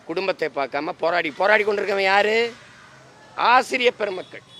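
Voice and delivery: ordinary delivery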